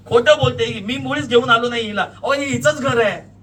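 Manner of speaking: very loud, high denial; desperate shouting. A narrator's reading. Accused man to police against woman, shouting desperately. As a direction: shout for dear life that she lies. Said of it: "तुम्ही जीवाच्या आकांताने ओरडता".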